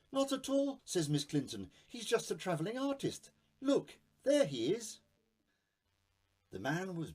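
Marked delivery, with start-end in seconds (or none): 0.1-0.8 much higher, lady's voice; Miss Clinton. Acted. Woman answering police. 1.9-5.0 much higher, lady's voice; Miss Clinton. Acted. Woman answering police.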